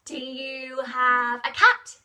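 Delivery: usual speaking voice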